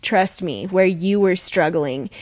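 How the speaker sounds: ordinary delivery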